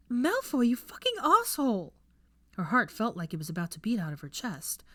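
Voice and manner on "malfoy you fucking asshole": high, loud furious yell; angry shout. A narrator's reading. Hermione yelling furiously at Malfoy in the woods. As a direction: scream it at him in frustration.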